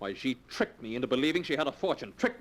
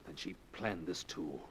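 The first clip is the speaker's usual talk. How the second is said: stunned hush; 9 semitones lower, 12 dB quieter, slower than usual. Acted. Drake stunned by the news.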